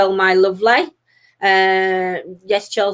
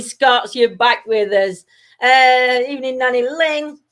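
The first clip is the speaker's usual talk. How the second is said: ordinary talk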